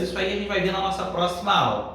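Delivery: normal talking voice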